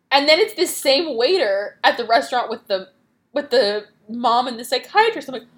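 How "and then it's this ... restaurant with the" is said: ordinary talk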